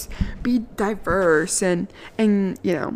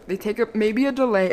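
ordinary delivery

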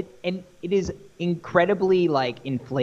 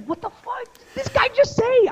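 much higher, imitating stunned confusion; baffled disbelief. Retelling the cashier's English price, high baffled disbelief. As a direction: shoot way up in mock bewilderment.

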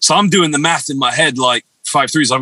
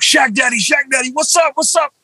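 retold excited shout; 10 semitones higher than usual. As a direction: call out like a starstruck fan, pitched way up. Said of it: "I just shout".

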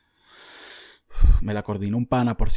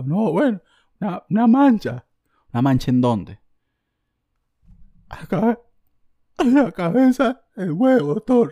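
much higher and louder, whimpering confession; hammed-up trembling voice telling the doctor where the spot is; cracking voice; 9 semitones higher, 7 dB louder than usual. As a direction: squeak it out like you're about to cry. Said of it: "con la voz toda quebrada".

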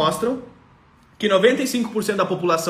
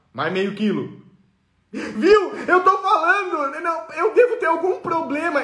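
exaggerated self-pity of a tired mother; complaining dieter. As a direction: sulk that nothing works for her. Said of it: "Como é que ela pensa?".

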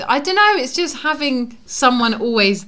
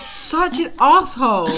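mock outrage; 5 semitones higher, slower than usual. Real, not acted. Mock outrage at sister's admission.

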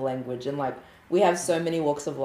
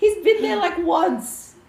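high, loud retold disbelief; incredulous protest; 6 dB louder, much slower than usual. Scoffing to a friend about his new Kiwi accent, incredulous.

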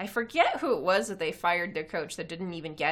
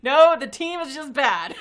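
laughing; 5 semitones higher, 9 dB louder than usual. Real, not acted. Laughing at a tweet.

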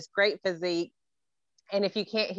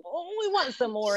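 high, eager "ooh" of wanting more; acted eagerness; 5 semitones higher than usual. Students begging the teacher for more, acted eagerness. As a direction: play the tantalized fan, pitched up.

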